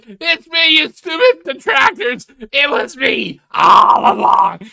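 high, loud triumphant yell; gloating reveal shout. Acted. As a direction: shout it like the unmasked villain. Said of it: "She'd be like".

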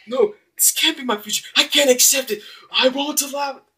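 high, loud desperate cry of refusal; anguished denial. Acted. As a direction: refuse your fate with everything you've got. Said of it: "he's not saying that".

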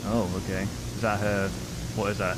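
ordinary delivery

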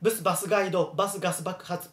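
バスガイド、バス、ガス爆発 run together quickly; quick, continuous tongue-twister delivery. Shown as a demonstration. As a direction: rattle off バスガイド and ガス爆発 without pauses. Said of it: "hablar rápido".

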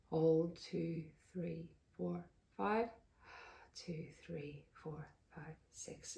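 very soft, measured count fading toward a whisper; barely voiced centering count; breathing count. Shown as a demonstration. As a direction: count quietly along with your own breath. Demonstrating her counted breathing exercise.

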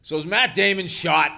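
put-on slurring drunk yelling a question; drunk shout. Drunk bar patron yelling at the writer, laughing shout. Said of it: "comes wavering on over".

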